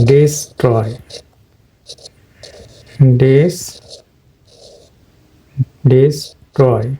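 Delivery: ডিস্ট্রয় in two parts, "dis" then falling "troy"; correct pronunciation. Shown as a demonstration. Teacher sounding it out while writing it in Bangla, correct pronunciation. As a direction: sound out ডিস্ট্রয় with an i vowel, pausing between tries. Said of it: "তাহলে এটা হবে ডিস্ট্রয়".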